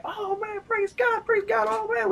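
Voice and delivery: very high, ecstatic praising; overjoyed shouts of praise. Retold or imitated. Jumping up and down, flipping out.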